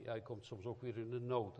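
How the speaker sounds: usual speaking voice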